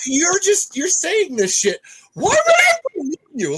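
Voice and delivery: high, loud outraged yell; angry shout. Real, not acted. Host yelling at a stalker's comment, high and loud. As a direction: yell it at him, fed up.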